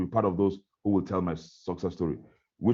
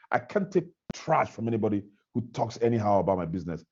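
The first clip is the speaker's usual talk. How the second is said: ordinary delivery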